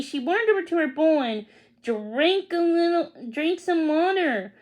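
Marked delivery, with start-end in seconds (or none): none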